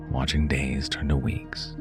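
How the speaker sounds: plain reading voice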